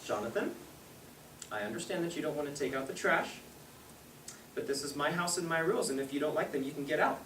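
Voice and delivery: low, quiet, controlled parental ultimatum; stern mother. Retold or imitated. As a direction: imitate a mom calmly laying down house rules.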